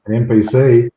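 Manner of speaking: loud disbelieving echo; indignant surprise. Real, not acted. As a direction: shout back in disbelief. Called an NPC, indignant.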